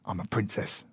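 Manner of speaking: hushed, low, a hint of a secretive child; secretive daughter. Father voicing his daughter's undercover princess secret, softly.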